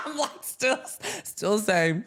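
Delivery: high, laughing through the words. Real, not acted. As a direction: giggle it out. Laughing at the friend's joke.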